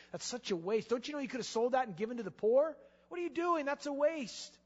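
제자들이 여인을 비난하며 높아진 목소리로 따지듯; scornful disciples. Retold or imitated. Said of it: "the rest of the disciples chimed in and said".

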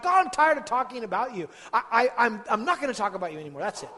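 loud, high, fed-up outburst at God; the weary prophet throwing up his hands, loud; exasperated prophet. Acted. Prophet complaining loudly to God, fed-up outburst. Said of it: "he said to God".

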